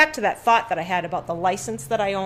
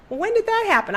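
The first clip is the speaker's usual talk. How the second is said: high, loud baffled question; as the puzzled husband; husband caught off guard, loud and high; surprised husband; 14 semitones higher, 6 dB louder than usual. Retold or imitated.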